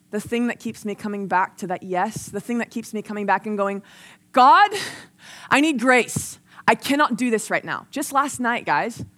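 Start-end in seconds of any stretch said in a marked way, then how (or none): 4.3-7.9 loud, raised cry to God, then easing; desperate plea. Acted. Preacher acts out begging God for grace, desperate. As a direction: call out urgently for help.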